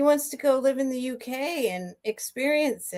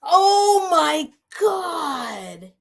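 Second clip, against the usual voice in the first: very slow, high, tearful oh my God; choked-up crying; 6 semitones higher, much slower than usual. Real, not acted. Overwhelmed by news for her daughter, crying. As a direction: let the tears break through. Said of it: "I'm gonna cry right now".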